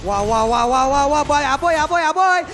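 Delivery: loud hyped play-by-play; esports caster. Real, not acted. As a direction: ride the fight call upward. Live play-by-play hype. (komentator esports bersemangat)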